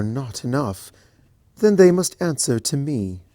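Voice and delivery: warm then a flash of anger; lightly stern royal voice; protective princess. Acted. Celestia protective, stern turn.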